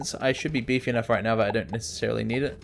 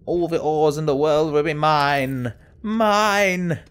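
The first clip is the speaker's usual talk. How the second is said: megalomaniac rant; 6 semitones higher, 3 dB louder, slower than usual. Pretend. Greedy gloating over found ores.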